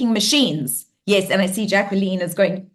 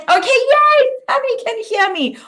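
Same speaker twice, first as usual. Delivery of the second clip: much higher squeal of joy; thrilled a viewer finally answers; delighted; 4 dB louder than usual. Real, not acted. A viewer replies in chat.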